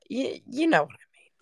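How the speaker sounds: a quick whisper straight to the viewers; whispered aside. Real, not acted. Whispering to viewers, let them in on the joke. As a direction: drop to a whisper for the knowing aside.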